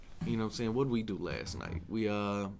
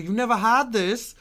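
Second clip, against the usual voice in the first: high, loud mock astonishment; retold disbelief; 12 semitones higher, 11 dB louder than usual. Friend listing off Chinese food, loud mock disbelief. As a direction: imitate an incredulous friend.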